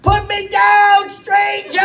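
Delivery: put-on very high kid scream; child screaming. Little son screaming at his father in a very high voice. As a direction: shriek it like a toddler. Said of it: "And he screams".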